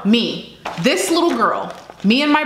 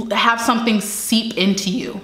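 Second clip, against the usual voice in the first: normal talking voice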